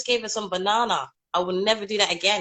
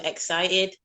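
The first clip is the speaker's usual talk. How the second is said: usual speaking voice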